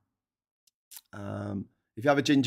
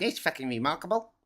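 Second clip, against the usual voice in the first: put-on higher-pitched New Zealand accent; 4 semitones higher, 3 dB quieter than usual. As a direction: put on a Kiwi accent for the quote. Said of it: "That's my New Zealand accent".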